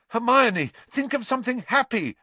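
high, urgent yell. A narrator's reading. Harry to Hermione as Dementors close in, yelled. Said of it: "Harry yelled".

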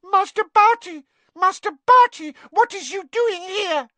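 very high, loud house-elf wail; piercing shriek. A narrator's reading. Winky finds her master's son on the floor, piercing shriek. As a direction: shriek it as a distraught little elf. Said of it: "let out a piercing shriek".

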